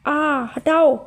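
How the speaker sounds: a mild yell of pain from a hot bag. A narrator's reading. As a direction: cry out that it burns, lightly voiced. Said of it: "चिल्ला के कहा". (हल्की दर्द भरी चीख)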